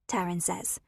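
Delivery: usual speaking voice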